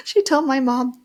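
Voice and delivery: high, laughing through the words. Real, not acted. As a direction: giggle it out, can't keep a straight face. Laughing while telling grandma's insult.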